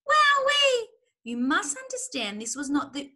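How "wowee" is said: very high, loud whoop; gasped amazement. A narrator's reading. Smallest fox after gulping strong cider, gasping whoop. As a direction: whoop at the kick of the drink. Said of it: "he gasped".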